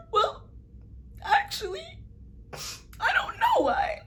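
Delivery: high, slow, wailing put-on sob; fake crying. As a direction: cry it out, half an act. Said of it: "the tear works had to come".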